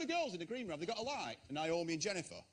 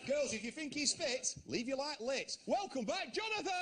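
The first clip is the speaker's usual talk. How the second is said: rising from measured patter to a high announcing call; show presenter's patter; 5 semitones higher than usual. Pretend. Presenter running his dating show for the host, building announcer call. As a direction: build to a big drawn-out welcome.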